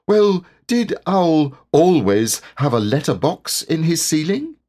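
high little questioning voice; small, puzzled high-voiced creature; Piglet, puzzled. A narrator's reading. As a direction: ask in high-pitched bewilderment. Piglet's voice in the story.

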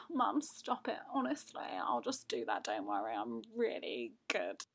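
soft, low, bashful aside; bashful flattered self. Retold or imitated. Daughter charmed by mum's compliment, soft and bashful. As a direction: mumble modestly, won over by praise.

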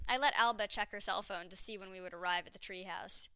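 plain reading voice

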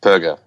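"Perger" with a hard G as in go; hard G pronunciation. Shown as a demonstration. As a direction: give "Perger" the g of burger. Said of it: "Hard G".

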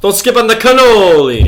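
high, loud Chicago-Italian accent; mock Chicago-Italian accent. Shown as a demonstration. Host redoes the cannoli line in a big accent. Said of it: "is another way you could say that".

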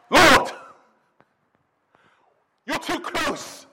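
Peter's anguished remorse. Acted. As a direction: plead as a man appalled at himself. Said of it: "That is the remorse kicking in".

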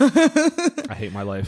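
mock despair. Mock self-pity after joke.

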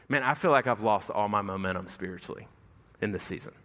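a touch of weary sadness; mild dejection. Retold or imitated. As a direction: sigh it out like a man who's given up. Dejected friend confesses spiritual slump.

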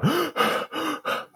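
rapid, high gasping breaths, acted out; hyperventilating gasps. Reenacting his breakdown in the counselor's office, hyperventilating. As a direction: imitate hyperventilating in short panicked gasps. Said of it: "to hyperventilate".

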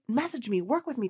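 ordinary talk

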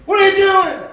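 high, loud barking teacher; drill sergeant. Retold or imitated. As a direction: yell at a careless student. Shop teacher yelling at student.

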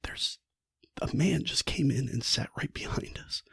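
hushed, breathless whisper in a dark theater; frightened whisper. Retold or imitated. Helen warns her friends in the cinema, frightened whisper. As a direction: whisper it so the man behind can't hear. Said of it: "turns around and says".

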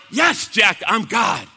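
loud, firm divine reply; booming voice of God. Retold or imitated. God confirming himself to Jack, booming. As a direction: declare it loudly as God. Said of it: "the voice booms out".

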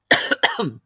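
short rough cough; coughing fit. Real, not acted. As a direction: cough hard mid-sentence. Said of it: "Sorry I'm coughing so much".

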